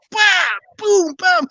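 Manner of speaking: high-pitched vocal booms of falling trees; imitated tree crashes. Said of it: "You can hear, like".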